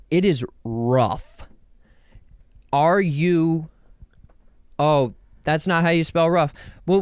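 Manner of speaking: ordinary talk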